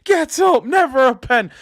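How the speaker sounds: hammed-up touchline yell at the ref; imitated yell at the ref. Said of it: "He'd be swearing at the ref for that".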